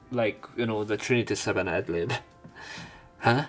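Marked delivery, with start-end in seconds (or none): none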